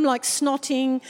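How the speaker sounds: ordinary talk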